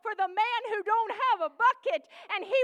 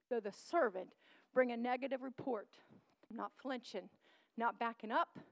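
softer, lower pastoral confession; hushed, intimate sermon close; soft preaching; 5 semitones lower, 9 dB quieter than usual. Real, not acted. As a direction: draw them in quietly.

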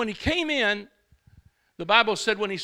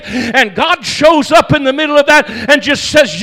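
loud, ringing preacher's cadence; a fired-up pastor driving the point home; full-force preaching; 6 semitones higher, 19 dB louder than usual. Acted. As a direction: preach it out to the whole church.

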